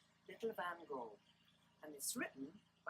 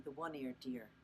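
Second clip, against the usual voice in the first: ordinary delivery, nothing marked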